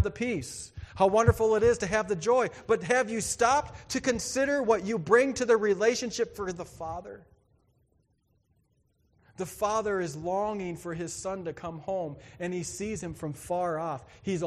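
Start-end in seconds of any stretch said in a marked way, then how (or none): none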